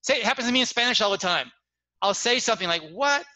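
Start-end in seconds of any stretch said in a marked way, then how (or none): 2.9-3.2 high rising query; short acted "huh?" reaction; baffled. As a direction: mimic a puzzled listener. Baffled listener, retold.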